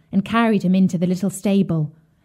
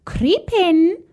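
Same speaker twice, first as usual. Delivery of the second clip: high, coaxing witch voice; luring witch; 10 semitones higher than usual. A narrator's reading. Witch tempts Gretel into the hot oven, high luring voice. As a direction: coax her in with a high lilt.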